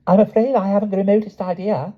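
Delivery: high, cold, posh brush-off; icy aristocratic dismissal; coldly. A narrator's reading. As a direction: freeze her out. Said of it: "the girl said coldly".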